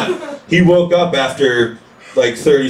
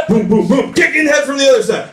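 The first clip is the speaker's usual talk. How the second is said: ordinary talk, nothing marked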